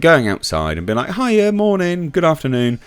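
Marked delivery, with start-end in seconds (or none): 1.1-2.8 high, chirpy mock hello; cheery greeting. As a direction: greet passers-by in a raised, friendly lilt. Acting out friendly greetings to passers-by.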